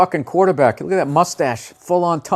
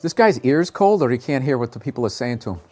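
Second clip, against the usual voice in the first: ordinary talk, nothing marked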